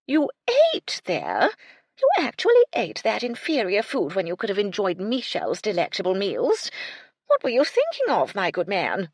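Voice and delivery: aghast aristocrat scolding; horrified dowager. A narrator's reading. Lady Belville appalled at the Colonel's pub meal. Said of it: "Lady Belville looked horrified".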